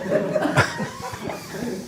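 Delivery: real laugh, jumping way up high; high squealing laugh. Real, not acted. Laughing at his cousin's outrage, a high squealing laugh. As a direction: burst into a high giggle. Laughing at own retold story.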